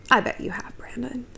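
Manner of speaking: whispered, knowing and sly. Retold or imitated. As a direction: breathe it like a private joke. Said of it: "And I was like".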